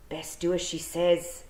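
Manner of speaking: slow, wary Scots wee-man voice; Scottish pictsie voice. A narrator's reading. Wee man nudging his mate, slow wary Scots. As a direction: warn your mate in broad Scots. Feegle advising.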